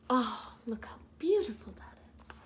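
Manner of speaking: soft whispered aside, a bit quick; hushed. Acted. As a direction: breathe it to the children in awe.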